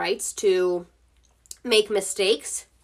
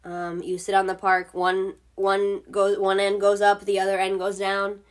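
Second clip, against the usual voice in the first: ordinary talk, nothing marked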